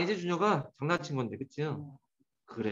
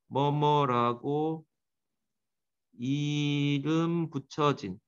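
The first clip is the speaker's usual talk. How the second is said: slow, drawn-out meaning for students to copy; slow dictation; 3 semitones lower, much slower than usual. Shown as a demonstration. Teacher reading the gloss aloud for students, slowly. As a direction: say it slowly so they can follow. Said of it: "뭐뭐라고 따라와".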